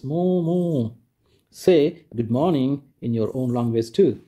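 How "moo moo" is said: long, drawn-out cow moos; imitated cow moo. Teacher showing pupils what a cow says, drawn-out mooing. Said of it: "A cow says".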